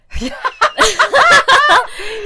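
loud, high burst of real laughter; long high cackling laugh; big laugh. Real, not acted. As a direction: crack up helplessly, loudly. Cracks up after slipping the name.

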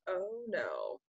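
soft, falling voice of dawning regret; retold dismay. Speaker recalling weeks off adaptogens, quiet retold dismay. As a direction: let it sink quietly, acting out the realization. Retelling reaction to stopping adaptogens.

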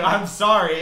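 high, loud retold apology, amused; laughing mock apology. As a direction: blurt the apology through laughter. Retelling own reply amid laughter.

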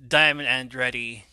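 ordinary talk

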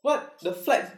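sharp high shocked what; surprised. Real, not acted. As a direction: react in total disbelief. Hears they missed flight.

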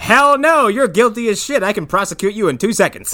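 loud, high, mock-indignant outburst; acted exasperated lawyer refusal; emphatic refusal. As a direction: blurt the verdict out at full voice. Acted lawyer refusing his client.

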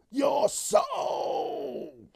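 high loud mock monster shout; hammed-up monster threat; mock horror cry; spooky threat. Spooky soul-eating threat.

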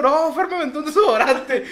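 high, loud imitated little brother; hammed-up complaint through laughter; mock tearful tattling. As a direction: whine it to the parents.

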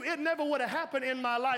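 usual speaking voice

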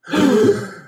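a sharp gasp of shock at the numbers. Acted. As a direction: gasp as if the news hit you. Bad sales figures shock the room.

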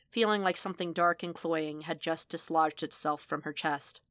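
ordinary delivery, nothing marked

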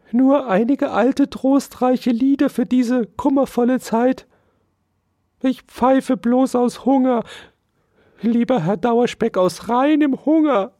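very high, pitiful mouse whine; wailing mouse. A narrator's reading. Hungry mouse explains the whistling to Dauerspeck, wailing. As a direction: bewail your lot in a squeaky mouse voice. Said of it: "jammerte die Maus".

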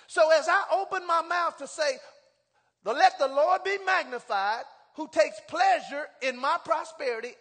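fiery pulpit reading; preaching at full force. Acted. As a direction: proclaim the verse to the whole church. Preaching the verse loudly.

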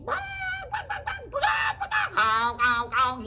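high squawks turning to loud honks; squawking birds. Acted. Hen and goose answer the duck, squawking. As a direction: clamour like panicking farm birds. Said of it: "And they said".

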